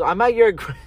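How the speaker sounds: high quoted line breaking into a laugh; mom's line cut off by laughter; cracking up mid-quote. Retold or imitated. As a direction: start imitating mom, then break up laughing. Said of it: "she didn't laugh".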